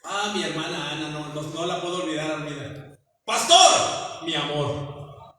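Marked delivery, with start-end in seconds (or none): 3.2-4.2 exclaimed call. Retold or imitated. Ana calling out to the pastor. 4.2-5.3 tender endearment. Retold or imitated. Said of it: "decirle a mi mujer vieja".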